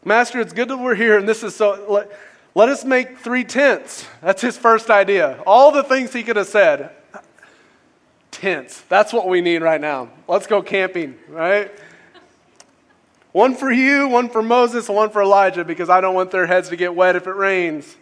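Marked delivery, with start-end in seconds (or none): 0.0-4.1 lightly hammed-up disciple, higher and louder; mock blurting Peter. Preacher mimicking Peter's first idea, mock blurting. 13.3-18.0 high, loud mock eagerness, easing down; mock earnest Peter. Preacher mocking Peter's tent plan, hammed-up blurting.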